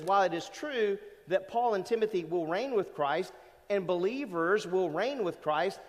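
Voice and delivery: ordinary talk, nothing marked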